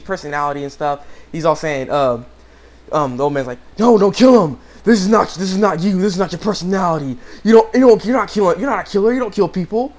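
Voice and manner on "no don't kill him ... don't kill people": pleading. Retold or imitated. Old man pleads with Shinji.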